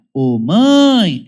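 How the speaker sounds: "Ô, mãe!" with the pitch climbing up; rising call. Shown as a demonstration. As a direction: lift "Ô, mãe!" upward, ascending. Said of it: "é uma ascendente".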